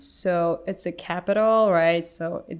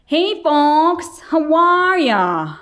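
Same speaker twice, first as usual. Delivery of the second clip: high, loud, slow Texan drawl, stretched out; mock a lazy Southern lecturer greeting the room; 8 semitones higher, 8 dB louder, slower than usual. Translator imitating her slow Texan speaker, hammed-up drawl. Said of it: "he would be so freaking slow".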